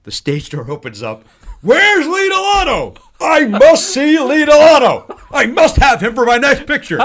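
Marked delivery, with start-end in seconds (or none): 1.6-7.0 slow, high, thunderous demand; hammed-up, drawn-out theatrical shouting; grandiose bellow. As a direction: burst in and declaim it to everyone. Mel bursts onto the set bellowing.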